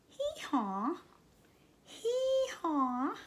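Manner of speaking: dainty high little bray; a small, sweet donkey-girl hee-haw. Shown as a demonstration. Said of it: "just a little hee-haw".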